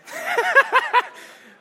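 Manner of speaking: high, loud yowling wookiee attempt; a shaky go at Chewbacca's howl; bad Chewbacca roar. Volunteer asked for Chewbacca, gives a loud high wookiee roar. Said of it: "That was a really bad impersonation".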